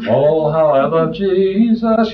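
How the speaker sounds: slow, sing-song mock of glib devotion; glib mock piety. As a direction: drawl it out like a shallow churchgoer. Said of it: "people so glibly say".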